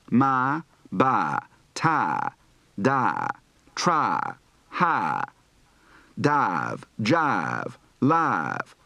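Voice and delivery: Southern drawl, flattened "my" and "tie"; Southern I to A shift. Shown as a demonstration. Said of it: "Practice words for the I to A shift".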